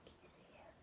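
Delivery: very soft whisper; whispered question. A narrator's reading. Teacher pointing at the wolf in the picture, whispering. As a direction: whisper so as not to wake him.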